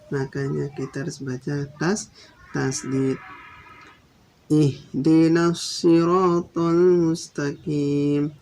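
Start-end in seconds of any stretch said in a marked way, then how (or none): 4.5-8.3 siratal with the s held doubled, slow. Shown as a demonstration. Teacher modelling the doubled s, slow careful recitation. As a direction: hold the double s in ihdinas siratal. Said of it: "Tasdidnya di atas sod".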